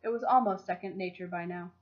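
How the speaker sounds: usual speaking voice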